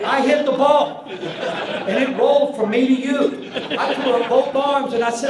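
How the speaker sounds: high, loud, bubbling with laughter; can't stop laughing at his own story; laughing while telling. Real, not acted. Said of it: "This is a good story".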